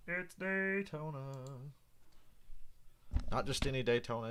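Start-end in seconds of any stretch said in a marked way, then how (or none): 0.1-1.2 high, slow, dainty sing-song; mock pansy voice. Shown as a demonstration. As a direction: say it like a prissy announcement, drawn out. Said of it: "in that pansy voice".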